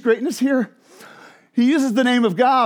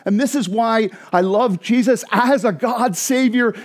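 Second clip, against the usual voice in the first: ordinary delivery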